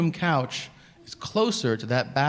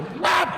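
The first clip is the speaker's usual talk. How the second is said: high, abrupt splat noise; imitated splat. Husband shows what he does before flushing, imitated splat. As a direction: make a sudden high-pitched cartoon splat. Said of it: "I just go".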